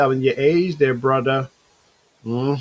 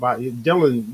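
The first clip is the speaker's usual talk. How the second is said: ordinary delivery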